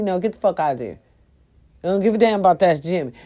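ordinary talk, nothing marked

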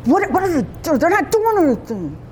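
a hint of a confused enemy soldier; puzzled enemy.

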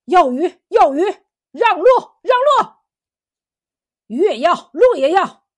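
loud, agitated crowd of officials; uproarious yelling, punched phrases; clamorous shouting. A narrator's reading. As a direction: shout demands over one another. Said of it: "乱哄哄的闹成一团儿".

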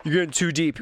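a lightly voiced listener teasing him; mock critic telling him he's gone overboard; imagined heckler. Listener heckling the host about going overboard, lightly voiced. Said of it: "welcome to the Russ Rants podcast".

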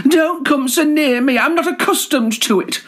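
high, alarmed ladylike voice; startled prim Garter. Acted. Garter fends off the boastful collar, high and flustered. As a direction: shoo him off in a high fluster.

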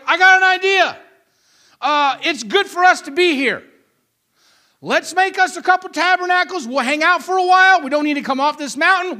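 hammed-up eagerness, punching the key words; eager enthusiasm. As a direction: play a keen disciple pitching his idea. Acts Peter excited on the mountain.

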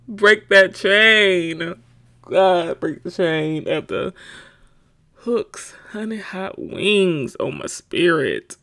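slow, loud preacher-style plea; mock prayer. Mock prayer to break her wing addiction, slow and loud. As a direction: pray it out like breaking a curse.

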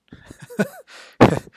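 short high burst of laughter. Real, not acted. The recapper laughs at the heroes' reply, high and short. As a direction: laugh at the awkward moment.

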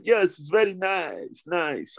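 high, slow drawn-out Borat voice; Borat impression. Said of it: "his party piece was speaking like Borat".

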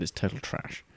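whispered self-mocking aside. Real, not acted. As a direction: drop to a whisper to trash your own advice.

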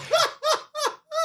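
high mocking cackle; mock laugh. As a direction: fake laugh at a lame joke. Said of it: "when they're just like".

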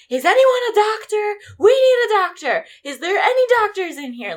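high, loud calling out to a crowd; retold urgent call. Event staff calling loudly for a doctor in the bullpen. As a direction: call for help across the hall. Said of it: "they were like".

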